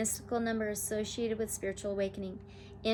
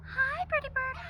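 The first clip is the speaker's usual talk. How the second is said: high tender coo; sweet talk to bird. Real, not acted. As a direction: charm the wild bird. Greeting the hawk.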